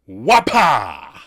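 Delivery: loud shouted wapa; wapa whip cry. Retold or imitated. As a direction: crack a silly whip call. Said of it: "he laughs and goes".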